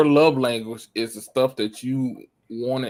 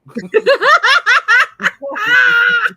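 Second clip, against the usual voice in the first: loud laugh; 10 dB louder than usual. Real, not acted. Laughing at her dad story.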